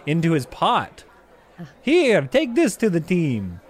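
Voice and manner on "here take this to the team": a touch of Italian chef, slow; Italian accent. Acted. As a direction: hand it over Italian. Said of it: "both boys speak in thick Italian accents".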